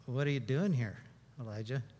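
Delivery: low, soft divine question; quiet, gentle God voice. Acted. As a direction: ask it hushed and kind. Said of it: "a voice said".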